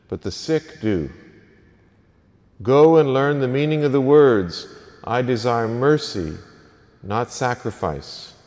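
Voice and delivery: measured, solemn liturgical reading; Gospel reading. Acted. Proclaims the Gospel reading at Mass.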